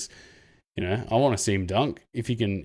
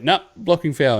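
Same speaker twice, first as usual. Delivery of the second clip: mock ref dismissal; 6 semitones higher, 4 dB louder than usual. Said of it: "they're just like".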